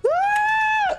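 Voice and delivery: very high, loud charging yell; battle scream. Retold or imitated. Mom charging a supposed intruder, loud high scream. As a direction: scream while charging with knives. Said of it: "she came charging me like".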